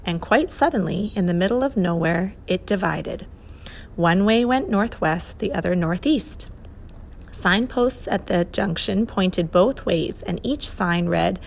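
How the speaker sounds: ordinary delivery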